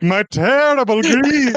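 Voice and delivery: mock dramatic line. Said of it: "you have to say that line".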